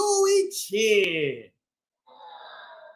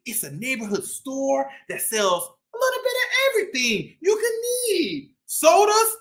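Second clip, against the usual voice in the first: lively shopkeeper cat telling his story; boastful bodega-boss cat, upbeat; cat narrator Chip; 3 semitones higher, slower than usual. Acted.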